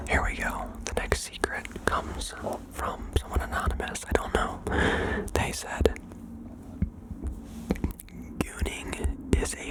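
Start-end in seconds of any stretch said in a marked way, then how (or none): none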